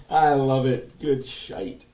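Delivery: ordinary delivery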